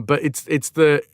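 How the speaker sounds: normal talking voice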